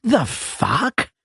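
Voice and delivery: high incredulous gasp of a question; stunned high-pitched the fuck; shocked. Acted. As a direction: spit it out in disbelief. Shocked at barred window.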